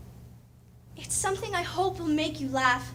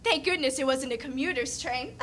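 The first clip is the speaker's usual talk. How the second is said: ordinary talk, nothing marked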